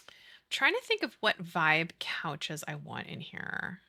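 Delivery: slow whispered musing; whisper to self. Real, not acted. Streamer musing to herself about couches, whispering. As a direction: whisper it as if thinking aloud. Muttering to herself while deciding.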